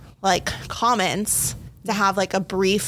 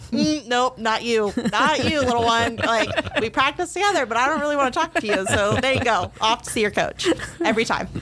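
higher, teasing "not you, little one"; playful dismissive brush-off; 4 semitones higher than usual. Retold or imitated. Coach teasing a middle schooler away, playfully dismissive. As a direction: shoo the kid off to their own coach, lightly mocking.

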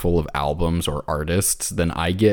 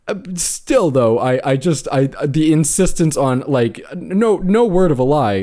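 excited; 9 semitones higher, 5 dB louder than usual. Real, not acted. Worked up over quoted arrogance.

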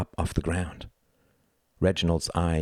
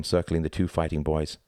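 usual speaking voice

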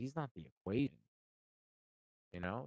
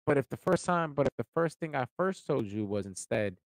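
normal talking voice

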